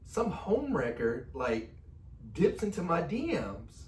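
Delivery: slow, high, hammed-up accusation; mock indignation. Husband teases wife about messaging him first, mock indignant. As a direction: draw it out as a teasing complaint.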